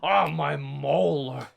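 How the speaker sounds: slow, hammed-up moan about a molar; mock toothache. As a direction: stretch it out like a bitten tooth hurts. Mock Eve biting doorknob.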